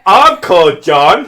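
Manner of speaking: loud, slow, laughing proclamation; mock drunken announcement. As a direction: proudly bellow it as a jokey drunk. Acting the drunk with own-name tattoo.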